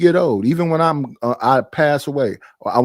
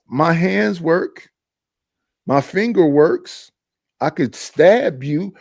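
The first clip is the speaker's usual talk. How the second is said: slow deliberate; 2 semitones higher, much slower than usual. Acted. Arguing with JP, deliberate.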